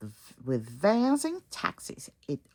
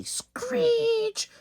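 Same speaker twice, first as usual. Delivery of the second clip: screeching brakes; 4 dB louder than usual. Acted.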